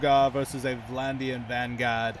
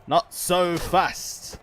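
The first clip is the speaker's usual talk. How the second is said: taunting shout; 5 semitones higher, 7 dB louder, much slower than usual. Acted. Taunting an opponent in a fight.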